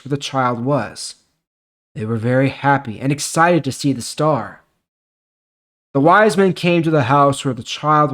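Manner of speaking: measured, clear Bible story narration; steady storytelling read; scripture reading. Acted. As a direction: read the passage like telling a story to the congregation. Reads scripture passage aloud.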